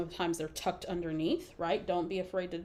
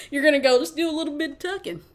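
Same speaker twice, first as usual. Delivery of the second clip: loud, high mock Southern twang; fake Southern accent; 7 semitones higher, 10 dB louder, faster than usual. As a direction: belt out the Southern accent, amused. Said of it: "I can fake a Southern accent".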